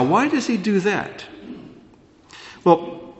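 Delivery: ordinary delivery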